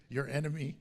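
ordinary talk, nothing marked

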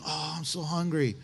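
low, soft, self-pitying moan; moaning complaint. Acted.

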